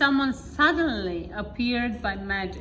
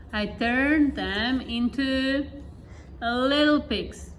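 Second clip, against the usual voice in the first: slow, drawn-out warning; a lightly voiced wizard, spelling out the punishment; slow threat; slower than usual. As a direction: let the threat land slowly.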